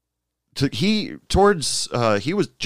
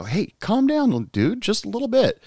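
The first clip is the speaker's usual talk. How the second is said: mock calming advice; 13 semitones higher than usual. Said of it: "I even advised him".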